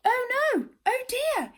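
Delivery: high squeaky frightened cry; frightened squeak. Acted. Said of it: "he squeaked in fright".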